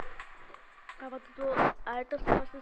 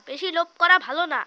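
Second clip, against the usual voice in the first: said again louder, as a saying; louder repeat; 3 semitones higher, 9 dB louder than usual. Shown as a demonstration. Repeating the proverb to viewers, louder. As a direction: drive the proverb home, louder. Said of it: "এই জন্য ভাই বলে".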